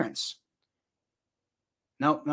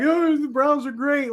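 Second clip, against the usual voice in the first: much higher, slow sing-song mockery of a gloating fan; mocking rival fan; 10 semitones higher than usual. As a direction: ham up a smug Browns fan, slowly. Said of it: "Miss me with that, like".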